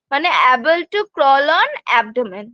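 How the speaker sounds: drawn-out English gloss; deliberate teacher gloss; slow dictation. Shown as a demonstration. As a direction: slowly spell out the definition. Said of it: "teacher glossing a term".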